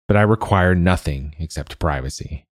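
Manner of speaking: plain reading voice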